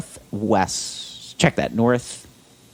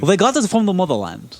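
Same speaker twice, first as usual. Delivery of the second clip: exaggerated, overdone fake Russian accent, higher; a clumsy Russian accent for a joke; bad Russian accent; 7 semitones higher than usual. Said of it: "that was a really bad Russian accent".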